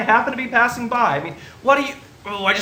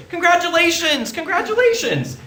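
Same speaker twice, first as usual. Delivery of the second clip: bursting with cheer, much higher; excited congratulations; 10 semitones higher than usual. Acted. Brother greeting his new sister-in-law, excited and much higher. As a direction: throw the congratulations out with delight.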